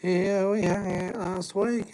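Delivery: high mock voice of the woman insisting; imitating her. Woman insisting they hung out, high mock voice. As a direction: pitch way up, slow and sure of herself. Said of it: "She goes".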